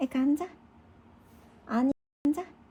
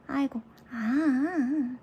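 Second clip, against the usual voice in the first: ordinary delivery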